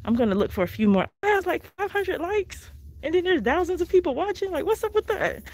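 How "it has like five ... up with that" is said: much higher, playfully begging; pleading. Real, not acted. To her live viewers, begging for likes, high and playful.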